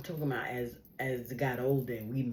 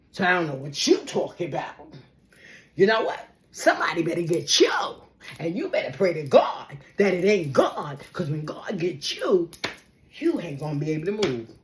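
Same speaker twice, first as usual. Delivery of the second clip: defiant threat; 3 semitones higher, 8 dB louder than usual. Retold or imitated. Confronting cousin's abuser.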